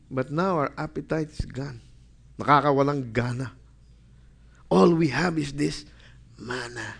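"But now our appetite is gone" said softly, sulking; mock sulky complaint. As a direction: sigh it like a bored, spoiled child. Preacher mimics the sulky complaint.

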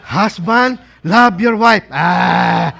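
loud rough reading ending in a groan; mock disgusted husband. Husband recoiling from the command to love, mock disgust. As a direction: read it out loudly with hammed-up distaste.

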